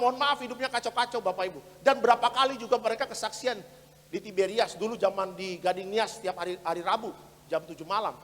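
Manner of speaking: usual speaking voice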